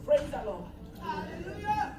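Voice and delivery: ordinary talk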